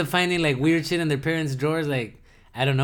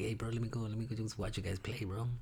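much softer timid plea; shy asking; 5 semitones lower, 18 dB quieter than usual. Retold or imitated. Poor kid asking friends to watch, soft and timid. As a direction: ask meekly to watch. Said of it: "I used to be like".

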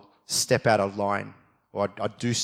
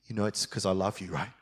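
softer, gentle pastor's words after a rebuke; tender reassurance; 4 semitones lower, 6 dB quieter than usual. Retold or imitated. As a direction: hand on the shoulder, soften right down. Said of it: "he'd put his hand on my shoulder and go".

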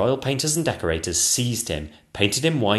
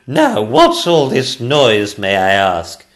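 very loud, slow regal demand; a haughty queen demanding an explanation; imperious Queen; 4 semitones higher, 12 dB louder, slower than usual. Acted. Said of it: "to make her look fierce".

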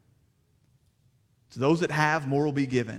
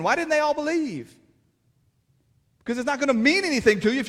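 preaching with rising force, louder and higher; fervent preaching; 10 semitones higher, 6 dB louder than usual. Acted. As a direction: drive it home like a revival preacher. Preacher building to full force.

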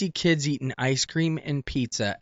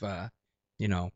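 ordinary talk

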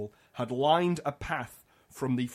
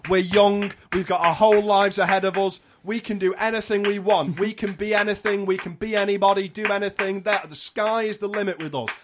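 raised, building youthful proclamation; acted triumph, listing endless possibilities; feeling fantastic; 5 semitones higher, 3 dB louder than usual. School leavers walking out to applause, elated mock-inspirational proclaiming. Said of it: "feeling fantastic".

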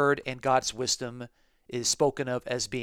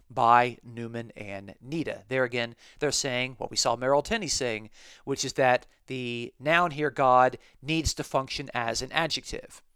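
normal talking voice

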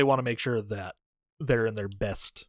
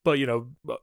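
normal talking voice

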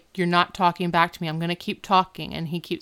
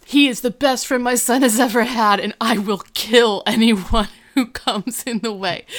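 hammed-up threat, higher and louder, breaking into a laugh; mock threat; 4 semitones higher, 5 dB louder than usual. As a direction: play the protective dad threatening with a laugh. Retelling Alan's threat, laughing.

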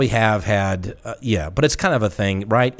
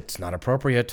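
soft imitation of a wife's aside; off-mic aside; 8 dB quieter than usual. Wife cautions husband thinking she's off mic, quiet aside. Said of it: "who thought she was off mic".

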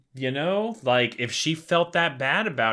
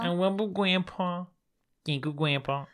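mock little-boy voice; 5 semitones higher, 3 dB quieter than usual. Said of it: "him being like".

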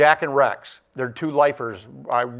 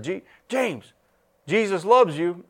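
higher, sing-song friendly inmate voice; imitating Rex; 6 semitones higher, slower than usual. Preacher retelling how Rex kept saying Jesus loves you, higher sing-song voice. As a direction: chirp it like a grinning, unbothered friend.

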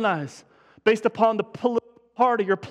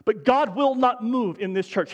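ordinary delivery

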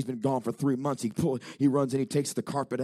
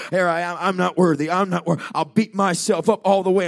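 raised, louder mock self-condemnation; loud self-reproach; 6 semitones higher, 8 dB louder than usual. Prodigal son declaring himself unworthy, loud self-reproach. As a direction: beat yourself up at preaching volume.

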